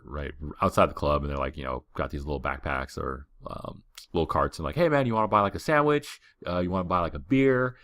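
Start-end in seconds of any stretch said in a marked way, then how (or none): 4.7-7.8 higher, chummy hawker calling out offers; street seller pitch. Retold or imitated. Seller outside the club offering sandwiches and beer, chummy pitch. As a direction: sell it like a friendly street hawker.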